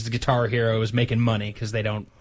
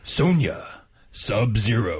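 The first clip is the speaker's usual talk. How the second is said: Mortal Kombat announcer voice; 4 semitones lower, slower than usual. Pretend. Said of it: "in a more Mortal Kombat announcer voice".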